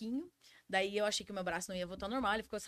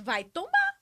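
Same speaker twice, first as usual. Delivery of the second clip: higher, louder startled cry; retold alarm; 8 semitones higher, 6 dB louder, much slower than usual. Truck driver recalling the rig lifting, alarmed cry. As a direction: relive the moment the truck tips. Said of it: "que que eu disse?".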